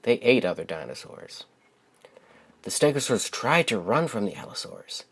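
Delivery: gentle, expressive storybook narration; lilting read-aloud voice for young listeners; reading to children. Acted. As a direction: read it like a bedtime picture book for kids. Reads picture book aloud.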